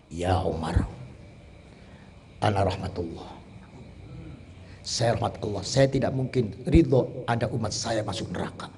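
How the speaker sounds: the Prophet's gentle answer, whispered low; hushed whisper. Acted. Prophet gently answering angry Umar, in a hushed whisper. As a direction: breathe it softly, tender and hushed.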